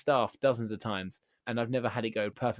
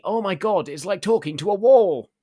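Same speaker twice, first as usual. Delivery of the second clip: higher, much louder exasperated yell; frustrated shout; 8 semitones higher, 11 dB louder than usual. Retold or imitated. Customer shouting at the unhelpful shopper, exasperated loud yell. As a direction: throw your hands up and yell it.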